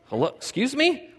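startled Boaz. Retold or imitated. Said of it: "wakes up and goes".

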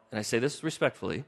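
ordinary talk, nothing marked

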